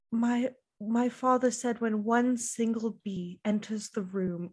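slow, put-on dramatic storyteller; soft, self-serious mock monologue; Dorit. Host mimicking Dorit's bee story, slow and theatrical. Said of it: "This is my monologue".

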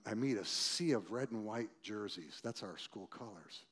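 ordinary delivery

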